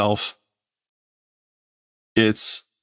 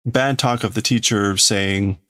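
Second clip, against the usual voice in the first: usual speaking voice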